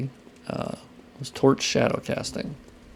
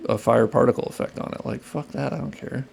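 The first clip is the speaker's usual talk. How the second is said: ordinary talk, nothing marked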